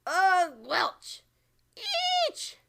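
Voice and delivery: high, loud gagging cries in a strange voice; disgusted yells. A narrator's reading. Giant spitting out the snozcumber, loud gagging yells. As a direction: gag and howl at the taste. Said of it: "yelled the blood bottler".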